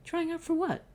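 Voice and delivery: high, confused question; puzzled boy voice. Acted. Will asks the judge, high puzzled voice. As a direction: pitch it up like a lost kid.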